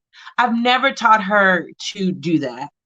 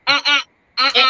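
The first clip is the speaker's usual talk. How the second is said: mock firm refusal; 4 semitones higher, faster than usual. Mother re-doing her firm uh-uh for the host. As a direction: echo the refusal lightly. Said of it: "Was it".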